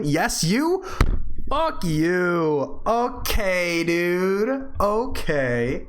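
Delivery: high, indignant retorts; playful hurt outrage; mock offended. Real, not acted. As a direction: act affronted at chat.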